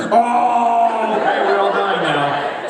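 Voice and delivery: loud, high comic death shout; mock dying cry. Acting out characters killed while asking for dates, mock shout. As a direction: cry out, then slow down as if dying. Acted people being killed mid-date.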